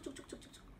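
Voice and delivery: soft whispered cuk clicks like keys; imitating a friend typing a search. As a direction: mimic fingers tapping a keyboard.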